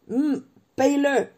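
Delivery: slow half-asleep grumble; sleepy grumble. A narrator's reading. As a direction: grumble without getting up. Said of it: "grommela Hagrid sans bouger".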